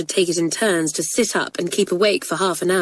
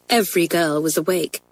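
plain reading voice

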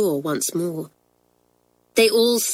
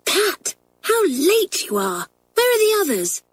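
put-on much higher, hushed surprised girl; whisper from above; 12 semitones higher than usual. A narrator's reading. Hilary whispering down to Pat, surprised. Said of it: "Hilary's voice ... in a whisper".

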